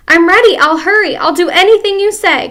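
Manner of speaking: desperate to please; frantic cry. Acted. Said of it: "cried Missus Twit".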